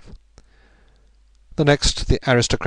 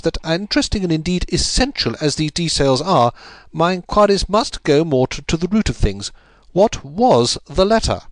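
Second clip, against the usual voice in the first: higher detective voice, insisting, slowing on the final question; Holmes, cool and firm; 8 semitones higher, 4 dB louder than usual. A narrator's reading. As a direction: press for the real answer. Narrator voicing Holmes.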